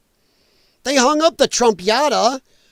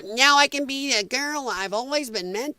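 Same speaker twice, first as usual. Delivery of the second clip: mocking child voice; 5 semitones higher, 4 dB quieter than usual. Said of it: "Probably comes out".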